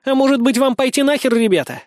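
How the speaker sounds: put-on high, cheeky girl's comeback; snappy retort. A narrator's reading. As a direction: snap back with mock politeness. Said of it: "огрызнулась Айша".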